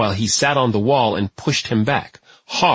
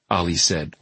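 plain reading voice

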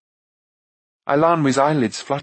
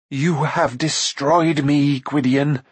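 slow, drawn-out accusation; a proud queen's understated fury; anguished cry; 2 semitones higher, slower than usual. A narrator's reading. Fallen queen blaming Gwydion for her ruin, slow anguished cry. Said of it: "she cried".

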